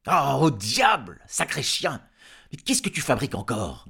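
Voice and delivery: loud, irritated cursing; angry farmer. A narrator's reading. Woken farmer to his noisy dog, angrily scolding. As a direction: burst out at the dog, loud and cross.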